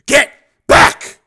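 frantic shout from the roof's edge; shouted warning. Acted. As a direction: bellow the warning down to the street. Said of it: "Steele shouted at the top of his lungs".

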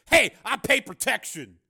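slow, loud, high-pitched protest; indignant and pitched well up; angry. Acted. Pimp protesting to the gunman, angry and loud. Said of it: "said the pimp angrily".

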